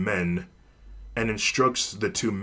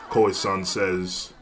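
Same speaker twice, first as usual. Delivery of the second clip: ordinary delivery, nothing marked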